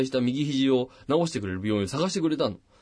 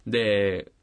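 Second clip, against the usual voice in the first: ordinary talk, nothing marked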